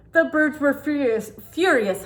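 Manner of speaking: put-on lively read-aloud for children; storybook reading. Reading a picture book to kids, storytelling voice.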